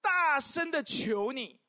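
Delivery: slow loud emphasis on "大声"; emphatic, slow. Shown as a demonstration. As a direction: press every word of "大声地求你". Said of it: "他强调一件事情".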